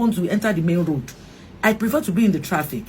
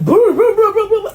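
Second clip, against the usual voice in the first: high loud brr brr car noise with a laugh; imitated engine revving; 12 semitones higher, 6 dB louder than usual. Officer driving the car away, imitated engine roar. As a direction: mimic a car speeding off. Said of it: "he was like".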